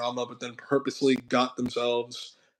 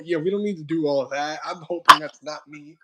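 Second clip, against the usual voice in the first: ordinary talk, nothing marked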